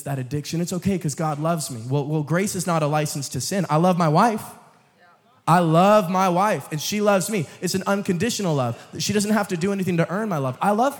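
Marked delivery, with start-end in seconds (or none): 3.7-6.7 emphatic declaration. Acted. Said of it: "sermon illustration".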